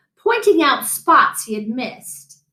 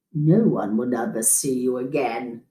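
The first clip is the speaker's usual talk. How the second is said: much lower, softer sinister aside; sly fox aside; 8 semitones lower, 5 dB quieter than usual. A narrator's reading. Fox secretly plotting to eat the dentist, low sinister aside. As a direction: mutter the threat under the breath. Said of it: "said the fox to himself".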